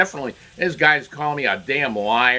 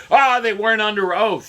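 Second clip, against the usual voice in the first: mocking the media; 8 semitones higher, 5 dB louder than usual. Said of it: "the other thing they said".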